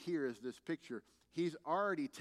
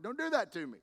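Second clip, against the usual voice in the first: higher-pitched playful complaint; half-joking, higher scolding plea; mock protest; 7 semitones higher, 4 dB louder than usual. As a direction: protest as if caught off guard. Mock protest at being fooled.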